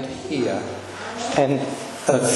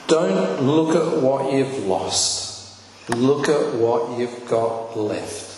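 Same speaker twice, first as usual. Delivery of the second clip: "Don't look at what you've lost" drawn out slow and weighty; slow, solemn reading; slower than usual. Shown as a demonstration. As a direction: read the tile slowly as a revelation. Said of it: "that was on the tile".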